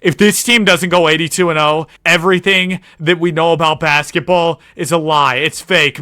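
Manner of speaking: fired-up vow about the team; hyped declaration. Real, not acted. Hyped promise about perfect season.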